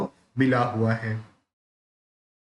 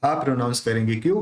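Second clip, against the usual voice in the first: ordinary delivery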